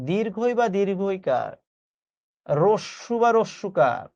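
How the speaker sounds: slow, measured reading of a rule; deliberate teacher dictation with pauses. Shown as a demonstration. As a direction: spell the rule out slowly, phrase by phrase. Reads out the rule slowly.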